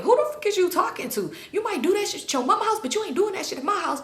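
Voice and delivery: high, rapid mock telling-off; imitated scolding dad. Dad telling off his mouthy daughter, high fast scolding.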